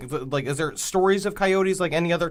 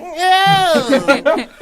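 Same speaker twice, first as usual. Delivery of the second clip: ghost wail; 11 semitones higher, 6 dB louder than usual. Retold or imitated. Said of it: "going like".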